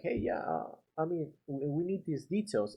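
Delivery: soft, breathy-free puzzled trailing; half whisper, a bit lost; confused tone. Shown as a demonstration. Contractor showing homeowner confusion, soft unsure tone. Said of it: "I'm acting, like, confused".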